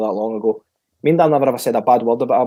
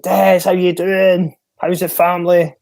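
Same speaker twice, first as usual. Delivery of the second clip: high, drawn-out, mock-hearty phone hello; imitated hearty greeting; 7 semitones higher, 5 dB louder, slower than usual. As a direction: imitate a manager calling out a big slow hello. Retold cheery phone greeting.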